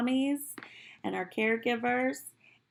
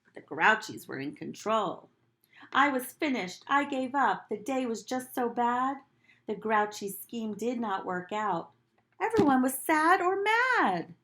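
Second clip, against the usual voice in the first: put-on gentle read-aloud to children; storybook reading; 3 semitones higher, 6 dB louder than usual. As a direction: read it like storytime for little kids. Teacher reads picture book aloud.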